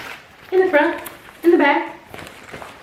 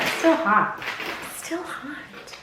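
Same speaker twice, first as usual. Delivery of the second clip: normal talking voice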